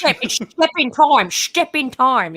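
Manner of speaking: "step in time" with step slurred to "shtep", drawn out slowly; slow, exaggerated "step in time", step said as shtep; mimicked film pronunciation. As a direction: stretch "step in time" like a bad film Cockney, sh in "Step". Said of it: "He says".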